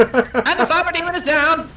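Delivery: high booming vintage announcer call; old radio announcer. Pretend. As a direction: announce the knockout like forties radio. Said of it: "an old-timey radio announcer in here to do this for us".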